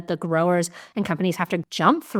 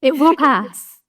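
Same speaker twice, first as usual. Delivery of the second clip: higher, louder, firm reassurance; louder emphatic promise; 7 semitones higher, 7 dB louder, slower than usual. Acted. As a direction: press it home. Reassuring repeat, first take emphatic.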